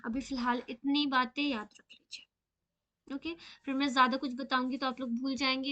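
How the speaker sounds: ordinary talk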